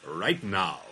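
low vintage announcer catchphrase; old-timey radio voice. Shown as a demonstration. Co-host showing his warm-up phrase, old-timey radio voice. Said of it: "get into their old-timey, uh, voice was".

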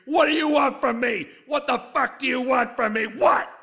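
loud, desperate demands; furious demand. Acted. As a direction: corner the panel and make them answer. Aggressive demand at panel.

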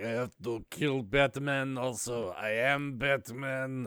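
slow, soft mock Russian accent; imitated Russian villain. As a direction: drag it out in a hammed-up Russian accent. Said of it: "I spent the entire episode just like".

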